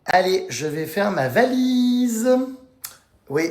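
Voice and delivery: ordinary talk, nothing marked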